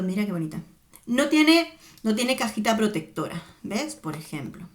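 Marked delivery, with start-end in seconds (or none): none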